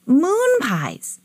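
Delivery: high-pitched boyish mock shout; shouted correction. A narrator's reading. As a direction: call it out to someone who misheard. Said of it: "Jimmy shouted".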